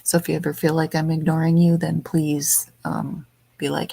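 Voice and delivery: ordinary delivery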